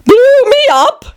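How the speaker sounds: very high, drawn-out queenly exclamation. A narrator's reading. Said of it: "panted the queen".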